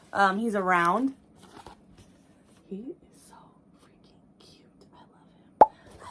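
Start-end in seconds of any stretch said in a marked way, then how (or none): 2.7-6.1 soft whispered gush over cuteness; whispering. Real, not acted. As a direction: breathe it out, overwhelmed. Gushing over finished craft.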